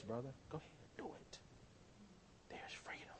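hushed, tempting whisper in the ear; soft tempting whisper; enemy's whisper. Retold or imitated. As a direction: lure him softly, like a snake. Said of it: "Does he whisper in your ear".

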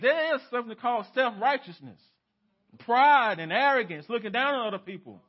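fervent pulpit warning; full-force preaching. Acted. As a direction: hammer each point home.

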